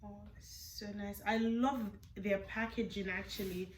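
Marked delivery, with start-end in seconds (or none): 0.1-1.2 whispering. Real, not acted. Hushed unboxing delight.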